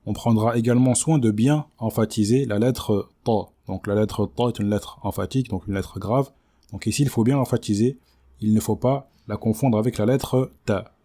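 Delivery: usual speaking voice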